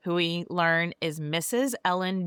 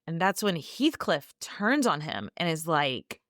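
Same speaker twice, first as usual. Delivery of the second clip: ordinary talk, nothing marked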